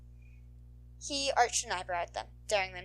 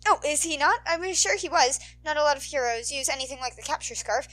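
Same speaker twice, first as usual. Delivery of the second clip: rushing, louder, breathless deduction; rambling kid; 6 dB louder than usual. Acted. Nervous boy rambling to the heroes. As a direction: reel it off, excited. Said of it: "the boy's ramble".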